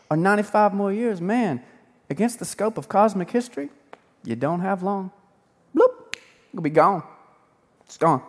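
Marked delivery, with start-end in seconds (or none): 5.7-6.1 bloop sound effect. Acted. Life vanishing sound effect.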